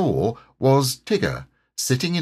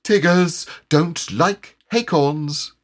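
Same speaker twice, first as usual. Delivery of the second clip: high, slow tiger pronouncement; firm, drawn-out character voice; 9 semitones higher, 3 dB louder, slower than usual. A narrator's reading. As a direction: state it word by word with finality. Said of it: "he said firmly".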